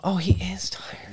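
hushed mock whisper. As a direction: whisper so he stays asleep. Mock bystanders at a sleeper.

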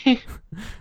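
brief amused laugh; quick laugh; short laugh. Real, not acted. Laughing at the story.

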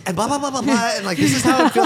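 mock vlogger talk. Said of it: "the way that you're just like".

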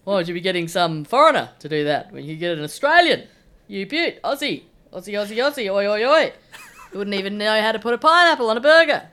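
knockabout talkback politician, building from plain grumble to loud rant; mock patriotic outrage, rising into a chanting shout; Peter Dutton. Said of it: "Your impression of Peter Dutton".

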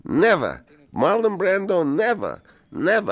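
emphatic refusal. Retold or imitated. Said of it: "he looks and he says".

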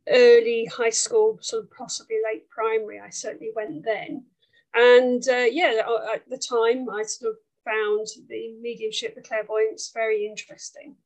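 ordinary talk

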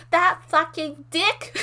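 high, drawn-out mock indignation; retold outrage. Quoting friend's first words about the ex, mock outraged. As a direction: stretch out each word in hammed-up disgust. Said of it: "you were just like".

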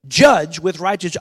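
Judge with righteous said slow and loud, every word leaned on; extra umph. Shown as a demonstration. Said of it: "a little extra umph there".

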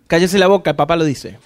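low mock-stern father, dropping off; voicing a dad who silences his son's question, low and stern; gruff father. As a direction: imitate a fed-up dad shutting down the kid. Father scolding questioning child.

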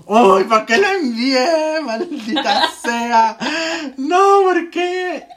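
high, slow, whining self-reproach; mock anguished whine. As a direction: wail it out in mock regret. Mock self-reproach after sending message.

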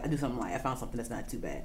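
low and soft; quiet. Real, not acted. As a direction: say it under the breath. Dropping voice.

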